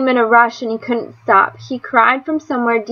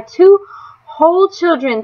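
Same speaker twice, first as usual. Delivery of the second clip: high, loud burst of relief; overjoyed thanks; 6 semitones higher, 3 dB louder than usual. A narrator's reading. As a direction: lift it up to heaven. Relieved praise after the gunshot.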